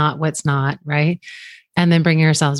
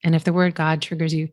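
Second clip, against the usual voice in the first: normal talking voice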